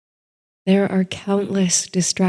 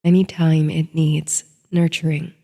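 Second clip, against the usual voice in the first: guided meditation. A narrator's reading. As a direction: ease the listener into calm breaths. Narrator leads breathing meditation.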